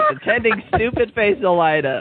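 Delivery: high, breaking into laughter; teasing mock title. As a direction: insist on the silly title, grinning. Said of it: "I ain't going back and changing it".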